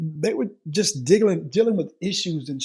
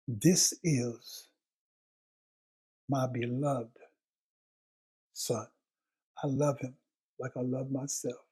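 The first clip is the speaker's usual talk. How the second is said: soft, slow and lower, each word held; tender reading; 4 semitones lower, 4 dB quieter, slower than usual. Shown as a demonstration. Pastor voicing God over his son, tender and slow. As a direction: speak it gently like a father's blessing. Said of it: "I want you to let that sink in".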